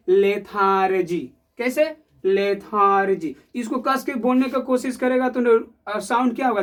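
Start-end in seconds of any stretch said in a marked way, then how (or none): 0.1-1.3 lethargy said slowly and lazily, syllables stretched; drawn out lazily. Shown as a demonstration. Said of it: "जो ऐसा sound जो धीरे से आवाज". 2.2-3.3 lethargy said slowly, each syllable stretched; drawn out lazily. Shown as a demonstration. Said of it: "धीरे धीरे बोल पाएगा".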